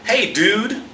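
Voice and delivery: casual student greeting. Retold or imitated. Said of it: "greeted me with".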